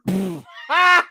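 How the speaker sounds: sudden loud, high burst of laughter; real guilty laugh. Real, not acted. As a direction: burst out laughing when you shouldn't. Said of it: "I shouldn't laugh".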